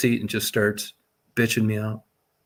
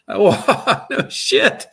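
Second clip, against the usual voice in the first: laughing through the words, pitched up; can't help laughing while telling it; 8 semitones higher, 5 dB louder, much slower than usual. Real, not acted. Tells the podcast host about walking off the plane, laughing.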